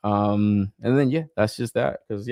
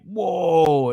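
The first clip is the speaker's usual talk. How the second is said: higher-pitched impressed whoa; quick imagined gasp of awe; mock amazed whoa; 6 semitones higher than usual. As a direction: act blown away by the toy. Imagined hype reaction to figure.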